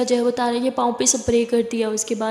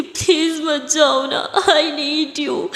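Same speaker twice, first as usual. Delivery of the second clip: drawn-out, clinging plea; slow tearful please don't go; slow pleading; 2 semitones higher, much slower than usual. Acted. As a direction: beg her to stay, very slowly. Tara begs Siya to stay.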